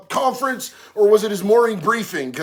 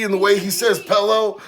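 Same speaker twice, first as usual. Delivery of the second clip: ordinary delivery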